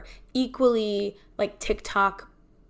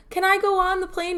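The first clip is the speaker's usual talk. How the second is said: high, pleading question; 10 semitones higher, 4 dB louder than usual. Retold or imitated. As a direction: beg the gate agent in a high voice. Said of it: "and I was like".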